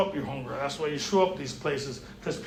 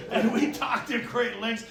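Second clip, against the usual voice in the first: normal talking voice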